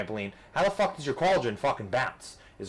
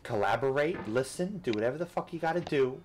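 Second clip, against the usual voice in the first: ordinary talk, nothing marked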